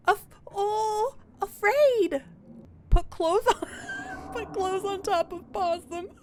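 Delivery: high, loud little-critter voice; excitable high-pitched animal voice; frightened possum. Acted. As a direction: squeak it out as a scared possum.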